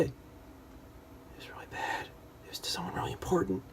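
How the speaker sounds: fast, voiceless, ashamed whisper. Retold or imitated. Daughter whispers her embarrassing confession to her mother, rushed. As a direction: hurry the confession out under your breath.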